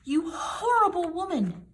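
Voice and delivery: high, loud furious scream; outraged shrieking insult. A narrator's reading. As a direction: shriek it with hatred. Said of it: "Anne screamed".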